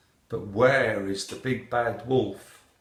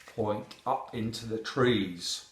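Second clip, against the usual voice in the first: plain reading voice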